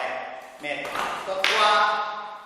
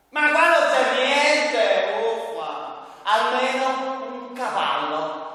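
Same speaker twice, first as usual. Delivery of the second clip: whiny slow puppet voice; 8 semitones higher, 6 dB louder, much slower than usual. Acted. Puppet whines for a toy.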